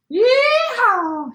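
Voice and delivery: very high, loud yeehaw whoop; cowboy shout. A narrator's reading. Gingerbread cowboy escapes the rancher, high whooping shout. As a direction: let out a high rodeo whoop. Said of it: "he shouted".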